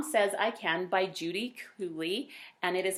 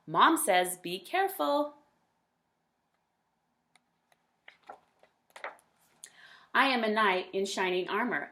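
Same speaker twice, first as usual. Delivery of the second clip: lively, louder storybook voice; reading to children; 2 semitones higher, 4 dB louder than usual. A narrator's reading. A parent reading a picture book aloud to kids, lively. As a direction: read it brightly to young listeners. Reads child narrator Max.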